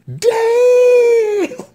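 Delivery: high, loud stretched yell; big shout. Retold or imitated. Picturing the knockout punch, a long loud high yell. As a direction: blown away by the hit.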